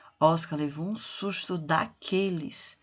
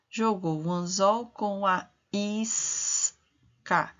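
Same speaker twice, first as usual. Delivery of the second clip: slow reading; slower than usual. Shown as a demonstration. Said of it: "reads text slowly to class".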